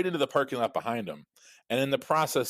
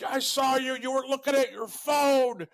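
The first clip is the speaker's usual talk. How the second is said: much higher, strained imitation of an enraged driver; mock angry accusation. Angry driver yelling at the other driver, hammed-up high squeal. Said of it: "And the guy's like".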